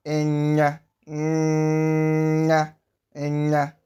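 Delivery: "enya" with a long nasal ny. Shown as a demonstration. Teacher modelling the digraph, lengthened ny in enya. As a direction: hold the nasal in "enya" before releasing the a. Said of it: "You can hear as I'm pronouncing".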